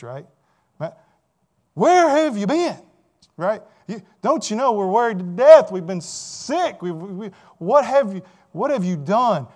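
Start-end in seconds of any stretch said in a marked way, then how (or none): 1.7-9.5 loud, high, panicked mother scolding her son; frantic scolding. Retold or imitated. Mary scolds twelve-year-old Jesus after searching, shouting. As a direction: let loose like a mother worried sick. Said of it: "Mary lets loose".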